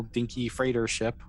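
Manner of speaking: normal talking voice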